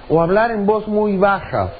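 ordinary talk

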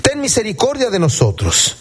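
high, insistent plea read aloud. Acted. Blind men refusing to be hushed, crying out. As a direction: press the plea harder, pitched high. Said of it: "pero ellos clamaban más".

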